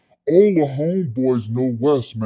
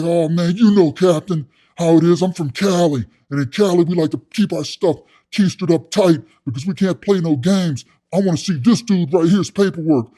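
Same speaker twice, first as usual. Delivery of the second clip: louder, higher inmate justifying himself; blustering cellmate; 5 semitones higher, 5 dB louder than usual. Retold or imitated. Inmate explaining Cali ways to captain, blustering. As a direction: protest to authority as the tough inmate.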